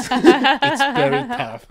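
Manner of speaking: slow, laughing through it. Real, not acted. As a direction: laugh the words out. Laughing remark.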